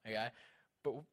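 normal talking voice

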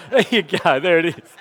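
amused chuckle at his own impression; laughing. Real, not acted. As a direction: giggle it out after the joke.

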